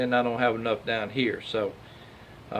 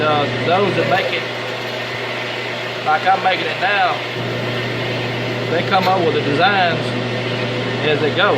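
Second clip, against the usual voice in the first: loud and higher, over machinery; raised voice over noise; 4 semitones higher, 4 dB louder than usual. Real, not acted. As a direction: project over a running lathe. Talking over the running lathe.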